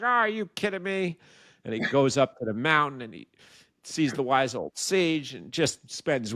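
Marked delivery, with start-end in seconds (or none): 0.0-1.2 cocky scoff. Retold or imitated. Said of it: "He's like".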